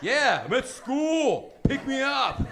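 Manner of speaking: put-on slow, loud slurred teen drawl; dopey teen giving directions lazily; slow teenager. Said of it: "I can't understand him".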